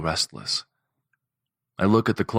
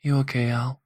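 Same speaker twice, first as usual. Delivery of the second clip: gentle concern; 7 semitones higher than usual. A narrator's reading. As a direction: ask it kindly. Juniper comforts Alice.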